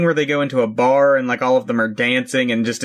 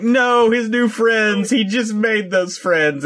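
1 high slow mock dismay; 9 semitones higher, slower than usual. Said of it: "and I'm like".

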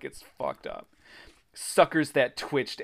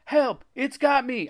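very high cry for help; panicked shriek; 12 semitones higher, 4 dB louder than usual. A narrator's reading. Boy with a blob on his leg, shrieking. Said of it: "I shrieked".

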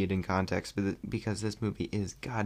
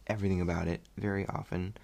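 normal talking voice

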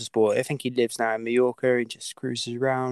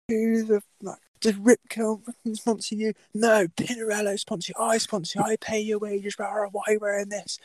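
much higher, ranting sponsor voice; mock furious boss; 9 semitones higher than usual. Team owner berating rider over wrong t-shirt, high ranting. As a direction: play the outraged team owner, pitched way up.

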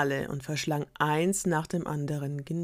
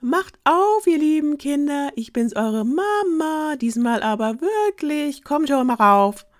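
wolf faking a much higher, slow, sugary mother voice; fake mama voice; 11 semitones higher, 7 dB louder, slower than usual. Wolf at the door posing as mama again, high and sweet. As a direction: coax them sweetly once more. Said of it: "weicher klang".

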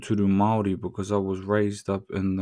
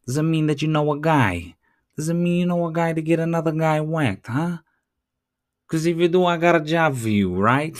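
higher, louder hammed-up mob boss; mafioso voice; 7 semitones higher, 4 dB louder than usual. Mimicking how mafiosos talk, hammed-up mob voice. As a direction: play a mock mafioso offering a job. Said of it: "you talk like this".

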